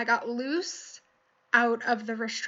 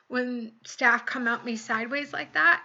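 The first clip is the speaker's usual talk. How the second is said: usual speaking voice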